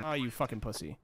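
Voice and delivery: low, quiet, offhand jab; soft muttered insult. Real, not acted. Gamer taunting a fleeing enemy, low mutter. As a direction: toss off "Oh, you fucking pussy" under the breath. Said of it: "I ate my words so fast".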